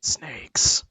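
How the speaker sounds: whispered voice of a talking corpse; rasping corpse. Acted. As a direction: rasp the word without any voice. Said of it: "it'll rasp out like".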